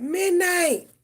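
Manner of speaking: loud, high yell across the yard; calling the cat. Retold or imitated. Owner calling her cat home, loud high yell. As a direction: holler the cat's name from outside. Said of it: "I be calling my cat".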